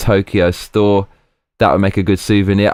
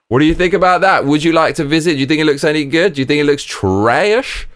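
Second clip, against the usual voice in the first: much higher, lively presenter voice; chirpy host questions; 7 semitones higher than usual. Real, not acted. Asking stream viewers their opinion, a high, lively host voice. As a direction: throw the questions to the chat brightly.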